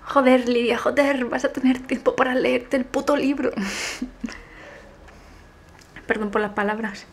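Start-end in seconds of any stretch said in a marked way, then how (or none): none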